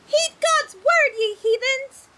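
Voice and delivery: very high, loud, slow proclamation; fervent sermon yelled at the sailors; zealous preaching shout. Acted. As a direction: bellow the holy word down at them. Preaching from the crow's nest.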